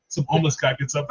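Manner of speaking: ordinary talk, nothing marked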